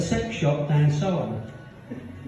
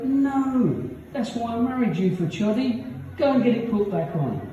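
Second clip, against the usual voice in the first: much higher wife's voice; nagging wife; 8 semitones higher than usual. Retold or imitated. Wife tells husband to get it put back on, high-voiced.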